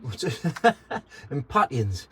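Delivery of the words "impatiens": impatiens said im-PAT-ee-enz; alternative pronunciation. Real, not acted. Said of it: "whatever you wanna call it".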